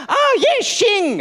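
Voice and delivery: very high, delighted character voice; amazed colonist. Acted. Colonist marvelling at the new accent, very high voice. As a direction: cry out high in wonder. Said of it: "He's like".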